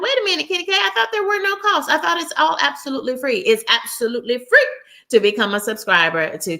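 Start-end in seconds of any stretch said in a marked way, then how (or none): 0.0-3.4 high-pitched imitation of a confused viewer; puzzled viewer objecting. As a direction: mimic a viewer's protest, pitched way up. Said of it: "And you're like".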